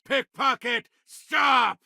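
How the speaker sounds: high, urgent yell after a pickpocket; shouting at a thief. Acted. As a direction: call out to stop the thief. Bystander yells at fleeing thief.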